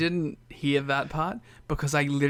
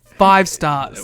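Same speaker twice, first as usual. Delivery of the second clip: much louder ringing declaration; emphatic verdict; 4 semitones higher, 12 dB louder than usual. Pretend. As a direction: slam down the rating. Emphatic rating after billing.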